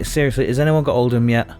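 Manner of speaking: ordinary talk, nothing marked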